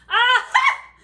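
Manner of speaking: loud high gleeful cry; queen triumphant at the princess's reply; triumphant aha. Acted. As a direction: crow it in triumph.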